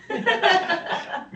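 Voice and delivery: high squealing laugh. Real, not acted. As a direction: bursts out laughing. Laughs at friend's reply.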